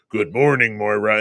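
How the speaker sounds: low, even greeting, lightly voiced; imitating Charles. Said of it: "you hear Charles' voice go".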